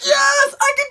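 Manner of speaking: high, loud pumped-up cry; self pep talk. Retold or imitated. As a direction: hype yourself into heroism. Pumping himself up.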